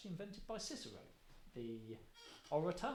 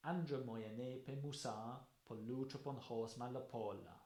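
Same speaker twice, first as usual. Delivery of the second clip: Greek verse recital. Shown as a demonstration. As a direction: chant the dactyls, long and short. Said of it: "let me give you an idea".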